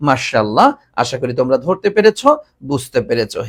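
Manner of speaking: ordinary talk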